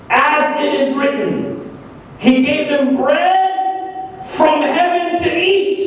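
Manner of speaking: high, slow, pointed scripture quoting; insulting challenge. Acted. The people lecturing Jesus with scripture, insulting challenge. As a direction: throw the verse at him, stretching each phrase upward. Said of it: "To me, this is insulting".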